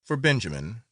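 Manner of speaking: plain reading voice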